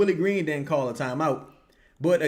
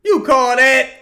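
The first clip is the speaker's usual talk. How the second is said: higher and much louder, indignant; outraged repeat; 8 semitones higher, 11 dB louder than usual. Real, not acted. Fan yelling at the ref over a bad call, outraged. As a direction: hurl it at the ref.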